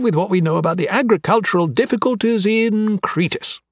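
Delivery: high, bubbly expert voice; enthusiastic, eccentric anatomist. Acted. Anatomist explaining wheat in the men's guts, enthusiastically high-pitched. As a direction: explain with irrepressible, lilting enthusiasm.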